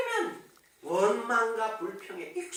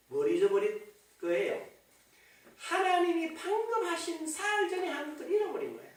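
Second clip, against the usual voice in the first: normal talking voice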